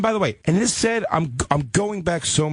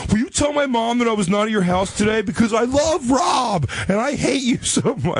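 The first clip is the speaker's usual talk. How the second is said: high, squeaky mocking of the ex on the phone; mock girlfriend voice; 11 semitones higher than usual. Mocking the ex-girlfriend on the phone, high squeaky voice. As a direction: ham up the ex-girlfriend's pleading call. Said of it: "I got that call".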